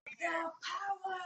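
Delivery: booming slow hero cry; He-Man shout. Retold or imitated. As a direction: bellow it like He-Man.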